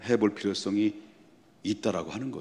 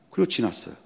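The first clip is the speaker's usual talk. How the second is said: ordinary talk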